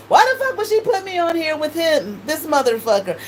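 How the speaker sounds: indignant quote. Retold or imitated. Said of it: "So I'm like".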